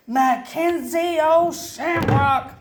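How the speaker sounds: loud, slow angry yell of the name; furious slow yell. A narrator's reading. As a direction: bellow your own name at the dog. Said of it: "I yelled".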